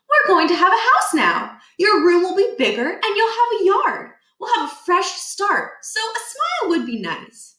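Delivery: a mom selling the move brightly; upbeat mom. Acted. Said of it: "Judy Murphy said".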